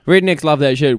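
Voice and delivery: ordinary talk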